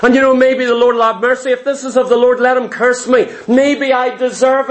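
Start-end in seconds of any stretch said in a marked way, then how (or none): none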